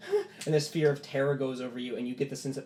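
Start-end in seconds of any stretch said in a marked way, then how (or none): 0.1-0.2 high, sharp, startled gasps; terrified gasps. Acted. Percival's hands move on their own, terrified gasps. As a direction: gasp in growing terror. Fear of terror comes over Percival.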